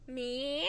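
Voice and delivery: drawn-out meow, rising at the end; playful, odd cat meow; weird meow. Pretend. Host signing off to her listeners, weird meow. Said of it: "I don't know why I'm meowing weird".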